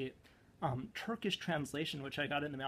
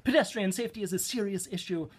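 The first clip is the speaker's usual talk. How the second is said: half slam poem, half Torah; 3 semitones higher, 5 dB louder than usual. Pretend. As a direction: slam poetry crossed with Torah recitation. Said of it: "This is also, like, half slam poem and half, like, Torah".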